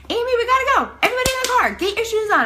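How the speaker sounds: high, loud urgent yelling; panicked screaming. Retold or imitated. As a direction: yell orders to rush everyone out. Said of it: "I'm screaming to get".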